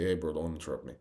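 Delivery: low, soft, unbothered correction; calm call-out. Shown as a demonstration. Speaker models calling out an interrupter without disrespect, calm and low. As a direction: say it quietly, no disrespect. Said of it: "don't be disrespectful with it".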